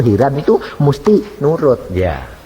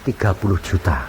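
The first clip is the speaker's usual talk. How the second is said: ordinary delivery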